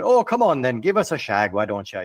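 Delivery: put-on a lad in an Irish accent coaxing his girl; mock Irish lad. Podcaster mimics the village boy, light Irish accent.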